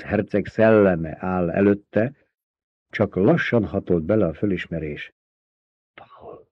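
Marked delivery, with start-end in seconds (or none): 5.9-6.5 breathy whispered name; stunned whisper. A narrator's reading.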